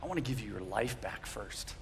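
Jesus answering the man who wants his legs; tender Jesus. Retold or imitated. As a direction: reassure him gently. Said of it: "And he goes".

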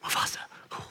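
hushed, shivery mock reverence; mock awe. Preacher playing along with a joke, in hushed mock awe. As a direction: breathe the name, then shiver at it. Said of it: "Yeah, say".

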